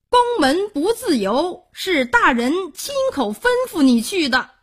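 high-pitched, peremptory court attendant; stern official messenger. A narrator's reading. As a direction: refuse him in a high official tone. 亲随回绝孔目请求. (官差高声回绝)

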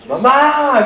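high, loud yell for mom; child shouting from the next room. Retold or imitated. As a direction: call for mom even harder. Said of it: "grita más todavía".

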